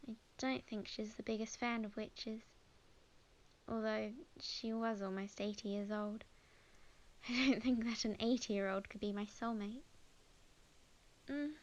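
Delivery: ordinary talk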